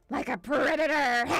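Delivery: loud mock growl rolled into the word; predator roar. Telling how she looked to the dog, predator roar. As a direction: roar it out like a beast, playfully.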